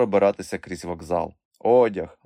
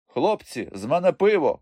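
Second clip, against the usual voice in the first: higher-pitched call to mates; gurgled call; 8 semitones higher, 3 dB louder than usual. A narrator's reading. Trader calling friends from afar, gurgled invitation. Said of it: "пробулькотів вдалині його голос".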